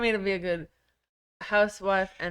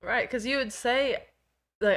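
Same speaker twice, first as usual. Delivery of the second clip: ordinary delivery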